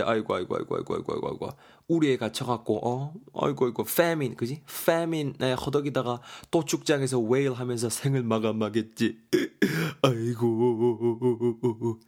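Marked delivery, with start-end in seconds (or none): 0.0-1.6 mock wailing. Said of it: "이렇게 지금 말을 하고 있습니다". 3.3-12.0 mock wailing. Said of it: "이렇게 지금 말을 하고 있습니다".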